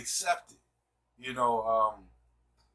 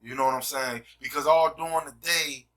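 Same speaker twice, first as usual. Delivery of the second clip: ordinary delivery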